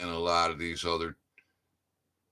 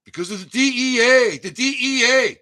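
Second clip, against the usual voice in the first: angry shout; 8 dB louder than usual. Real, not acted. Ranting against the DEA.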